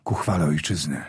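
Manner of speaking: low, soft hushed farewell; solemn whisper. Acted. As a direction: murmur it like a prayer over the fallen.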